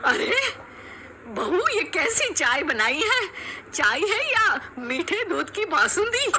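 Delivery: high, exasperated complaint; disgusted mother-in-law. Acted. Mother-in-law complaining about the tea, high and exasperated. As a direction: scold the bahus over sweet tea.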